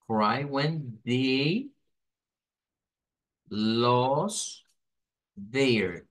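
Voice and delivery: "Cry when they lost their" word by word, each fully stressed; very slow, separated beats on "Cry", "when", "they", "lost", "their"; every word stressed. Shown as a demonstration. Teacher marks stressed syllables for students, slow and separated. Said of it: "como que fueran stress syllables".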